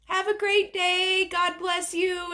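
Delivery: high, drawn-out mock calling out; imitated window farewell. Singer hanging out car window to fans, imitated calling out. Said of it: "hung out the window, and he's like".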